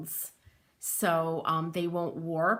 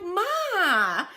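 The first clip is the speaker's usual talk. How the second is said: high, loud exasperated "Ma!"; exasperated protest; 11 semitones higher, 6 dB louder than usual. Retold or imitated. Daughter protesting at her Sicilian mom's claim, exasperated. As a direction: protest at mom like a grown daughter. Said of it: "And I'm like".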